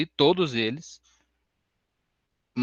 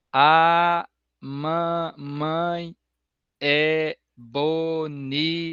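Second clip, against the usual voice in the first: very slow, syllable by syllable, higher; child reading syllables; 3 semitones higher, much slower than usual. Retold or imitated. Teacher imitating a child reading syllable by syllable. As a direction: sound out each syllable like a beginner reader. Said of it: "Ela lê".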